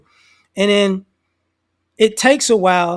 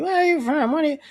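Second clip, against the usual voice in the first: mock old lady; 7 semitones higher, faster than usual.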